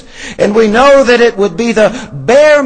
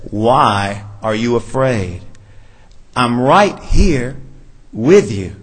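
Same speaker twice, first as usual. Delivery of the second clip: lower, slower, tender voice of comfort; calm them as if they're frightened children; a touch of a soothing Jesus; gentle reassurance; 8 semitones lower, 3 dB quieter than usual. Acted.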